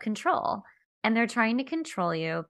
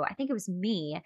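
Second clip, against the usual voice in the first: ordinary delivery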